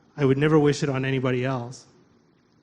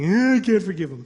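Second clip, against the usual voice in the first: whiny, higher mock refusal; balking; 4 semitones higher, 5 dB louder than usual. Arguing with the radio about forgiving, mock balking. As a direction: balk loudly with a drawn-in grumble.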